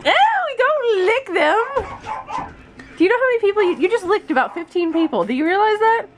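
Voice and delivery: squealing grossed-out scolding; high, loud, disgusted scold; high-pitched disgust. Real, not acted. Scolding a child licking things.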